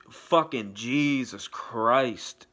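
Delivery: slow, drawn-out exclamation; awed swearing. Real, not acted. Raving to viewers about a gorgeous actress, slow awed emphasis. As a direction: stretch each word in amazement.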